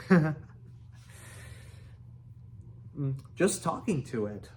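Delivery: understated sheepish mumble; embarrassed mumble. A narrator's reading. Hiccup owns up to Fish Legs, mildly embarrassed. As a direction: admit it with mild embarrassment. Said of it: "mumbled Hiccup, very embarrassed".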